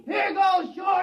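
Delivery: slow, loud and high taunting yell; defiant shout. Acted. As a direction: hurl it out like the rock itself. Throwing a rock at the robot.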